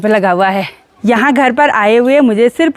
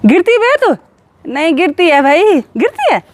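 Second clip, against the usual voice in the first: playful teasing; 6 semitones higher than usual. Real, not acted. Aunt playfully asks her toddler niece if she falls, much higher. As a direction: tease the little one gently.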